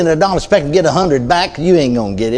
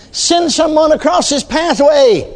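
high, slow, drawn-out preacher's cry; impassioned retold plea, stretched and high; fervent prayer; 12 semitones higher, slower than usual. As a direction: call it out slowly to heaven.

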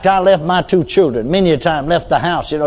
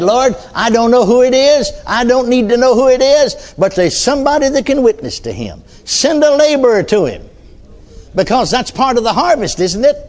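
high-pitched, impassioned preacher's plea; retold prayer in a raised, insistent voice; fervent prayer; 8 semitones higher than usual. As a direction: call out to the Lord with conviction. Re-enacts his bold prayer to God.

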